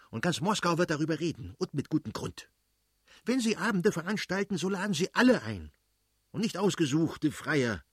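high-pitched, indignant tirade; fly off the handle at the wife; irate, emphatic scolding; flaring-up anger. A narrator's reading. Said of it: "brauste der Fürst plötzlich auf und schrie".

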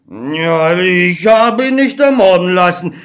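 lightly voiced God pleading innocence; defensive old God. God answers Beckmann's accusation, defensive and weary.

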